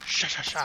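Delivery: loud, rapid "sha" bursts; punchy mouth noise. Pretend. Player makes attack noises in a game, punchy mouth noise. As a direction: fire off three hard hissy syllables.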